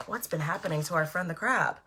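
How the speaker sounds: plain reading voice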